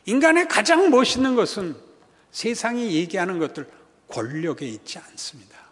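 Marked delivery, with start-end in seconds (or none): 4.1-5.6 sudden hushed whisper; preacher's dramatic whisper. Real, not acted. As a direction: drop to a breathy whisper for weight. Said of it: "preacher drops to a whisper".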